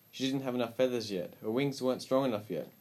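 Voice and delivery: usual speaking voice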